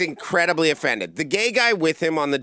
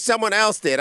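indignant retort; 8 semitones higher than usual. Retold or imitated. Offended man snapping back at the comic, high and indignant. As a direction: bite back defensively.

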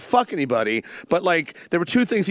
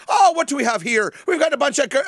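higher-pitched mock Irish brogue; attempted Irish brogue; 8 semitones higher than usual. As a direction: imitate a hammy actor attempting an Irish accent. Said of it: "Are you doing an Irish brogue".

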